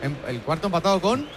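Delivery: talking around a pen; pen clenched in mouth; pen in teeth. Real, not acted. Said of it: "Sácate el boli de la boca".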